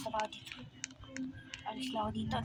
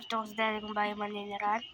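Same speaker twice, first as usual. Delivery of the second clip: fast; 4 semitones lower, much faster than usual. Acted. Rushed line.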